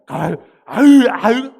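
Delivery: very loud, rough mock yells; brash, tough girl. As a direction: bellow like a pushy, forceful girl. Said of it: "오히려 힘세고 막 드세고 막". (드세고 힘센 여자 흉내로 크게 내지르기)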